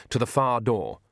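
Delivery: plain reading voice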